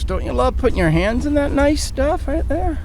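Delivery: high-pitched mock enthusiasm; playfully sarcastic, voice lifted high; sarcastic delight. Real, not acted. Sarcastic remark on grimy foam.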